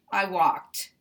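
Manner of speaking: walked cut off right after the T; stopped before the vowel. Shown as a demonstration. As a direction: end walked on a crisp stop. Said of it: "stop before we get to this vowel".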